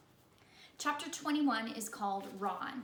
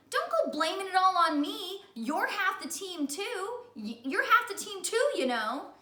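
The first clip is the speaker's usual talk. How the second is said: high, loud protest; loud indignant child; indignant Ron; 9 semitones higher, 7 dB louder than usual. Acted. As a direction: push the blame back.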